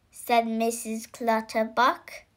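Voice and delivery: plain reading voice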